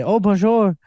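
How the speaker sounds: put-on French lilt; French-style greeting. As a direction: imitate colleagues showing off their French. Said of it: "do the opposite where they say".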